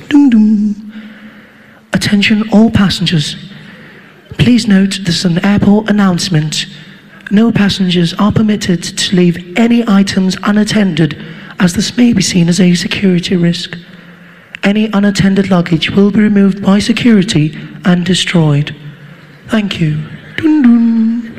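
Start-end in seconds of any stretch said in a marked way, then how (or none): 0.1-0.7 sung two-note airport chime; chime imitation. Comic imitating the airport chime before an announcement. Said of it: "that woman come on the system". 1.9-20.0 lightly voiced calm announcer, measured and even; calm airport announcer. Comedian imitating the calm Heathrow announcement voice. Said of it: "You'll hear that woman come on the system".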